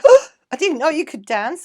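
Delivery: high, loud burst of delighted surprise; surprised. Retold or imitated. Spun round by her boyfriend on the floor, loudly surprised. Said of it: "and I was like".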